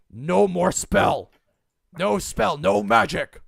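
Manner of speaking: loud, slow orc bellow; loud orc command. Acted. Orc shaman loudly orders Braxton to stop, slow and booming. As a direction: boom it out like a bored brute. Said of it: "turns to Braxton and goes".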